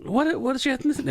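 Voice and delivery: insistent customer. Retold or imitated. Customer still asking worker's ethnicity, high quick voice. Acted customer pressing the question.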